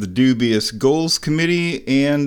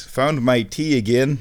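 ordinary talk

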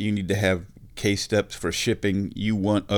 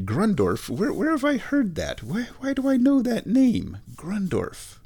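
high-pitched mock bewilderment; puzzled listener; 12 semitones higher than usual. Host voicing the audience wondering where they heard Grundorf, mock puzzled. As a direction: voice a baffled listener racking their brain, high. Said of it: "You're thinking".

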